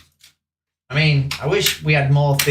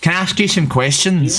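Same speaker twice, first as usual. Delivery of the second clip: higher-pitched Northern Irish lilt; Northern Irish accent; 5 semitones higher than usual. Acted. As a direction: put on a Belfast accent. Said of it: "told to do a Northern Irish accent".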